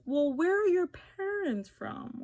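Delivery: high, slow prying voice of a nosy stranger; nosy questioner. Retold or imitated. Stranger pressing her about her parents' origins, prying and high.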